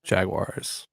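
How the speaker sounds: Jaguars as "JAG-wahrz"; two-syllable Jaguars with an American R; American pronunciation. Shown as a demonstration. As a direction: answer with Jaguars as "JAG-wars". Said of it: "What do you say?".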